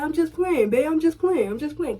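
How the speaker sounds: high, quick mock reassurance; hammed-up backpedal; boyfriend backpedaling. Boyfriend taking back the pregnancy joke, high mock plea.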